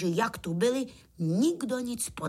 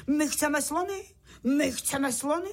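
higher-pitched demanding call; mild storybook shout; 6 semitones higher than usual. A narrator's reading. One bug demands elephants at the circus, a mild shout. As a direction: call it out like an eager spectator. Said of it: "Tak začal křičet".

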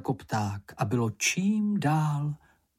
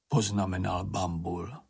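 usual speaking voice

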